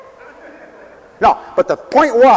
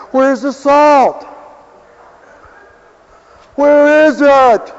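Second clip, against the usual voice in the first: high, slow, loud mock plea; mock searching diners; 8 semitones higher, 5 dB louder, much slower than usual. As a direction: call out as the diners who miss the salt. Voicing people missing the salt.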